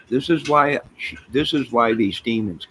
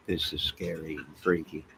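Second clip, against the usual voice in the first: usual speaking voice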